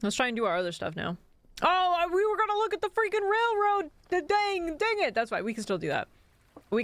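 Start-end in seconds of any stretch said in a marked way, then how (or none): 1.6-5.1 frustrated outburst. Real, not acted. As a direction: blurt it out in annoyance. Forgot the railroad, frustrated outburst.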